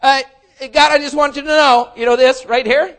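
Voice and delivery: loud, high, cheeky bargaining; bargaining youth. Acted. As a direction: play the selfish young person.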